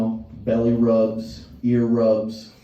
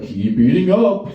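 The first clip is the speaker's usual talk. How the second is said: slow, raised coaxing voice; coaxing Tony; 7 semitones higher, 5 dB louder, slower than usual. Retold or imitated. Tony the Tiger urging the woozy kids, slow. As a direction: push them to keep eating. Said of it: "He's like".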